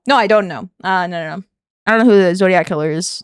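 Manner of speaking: usual speaking voice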